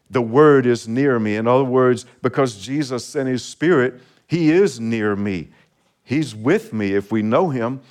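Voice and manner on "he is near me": ordinary delivery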